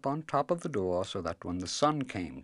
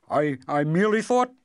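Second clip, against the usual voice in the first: fast, high, loud nervous peddler; nervous, hurried; 9 semitones higher, 9 dB louder than usual. Acted. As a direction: rush it out, stammering with fear. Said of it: "he added quickly".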